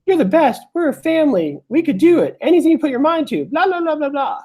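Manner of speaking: high, sing-song mock pep talk; mock cheerleader gushing. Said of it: "people are telling you".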